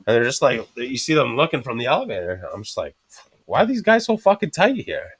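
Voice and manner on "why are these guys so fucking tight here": indignant question. Retold or imitated. Retold indignant thought.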